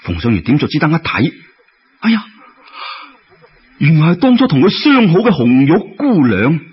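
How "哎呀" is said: usual speaking voice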